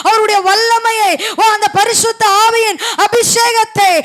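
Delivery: loud, sustained revival-preacher cry; full-force preaching. Real, not acted. Preacher assuring the congregation that Jesus speaks, full-force preaching. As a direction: proclaim it ringingly, phrase upon phrase without letting up.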